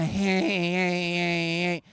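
droning engine whine in repeated pulses; imitated car noise. Car owner showing mechanic the noise, droning imitation. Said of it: "I have to do things in noises".

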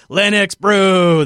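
slow, drawn-out hype reveal; excited announcement. Shown as a demonstration. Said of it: "a thing called Linux Brew".

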